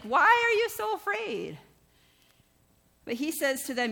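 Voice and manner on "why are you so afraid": pitched far up, loud and slow, disbelieving; incredulous challenge. Retold or imitated. Preacher echoing Jesus' question to the congregation, incredulous and loud. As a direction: throw the question back in disbelief.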